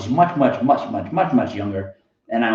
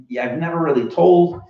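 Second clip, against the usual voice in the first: usual speaking voice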